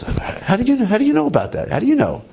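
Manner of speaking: retold shock, pitch jumping high; startled high-pitched disbelief, acted out; astonished questioning. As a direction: ask it high, as if caught completely off guard. Stunned rabbi confronts friend's revelation.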